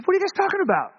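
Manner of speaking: high, comic mock-innocent question; [name], playful. [name] asks what they are discussing, comically innocent. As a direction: pitch it way up, playing innocent. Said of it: "[name] is hilarious".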